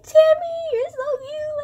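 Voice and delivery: cooing mom. Pretend. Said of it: "I'm gonna be like".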